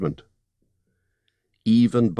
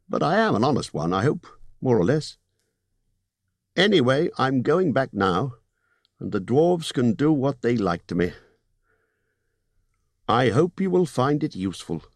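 高めの声で念を押すホビット; 利益の取り分を強調する商談口調; Bilbo's voice; 6 semitones higher than usual. Acted.

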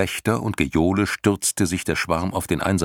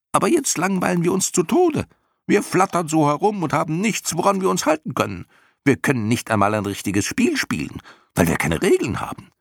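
high-pitched, whining creature chatter; chattering complaint; 11 semitones higher than usual. A narrator's reading. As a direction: gripe in a high clownish voice. (hoch schnatterndes Klagen)

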